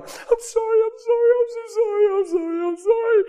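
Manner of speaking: very high, rapid-fire mock wailing apology; imitated frantic apology. Wife running across the yard to her husband, frantic imitated apology. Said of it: "She's running across the yard going".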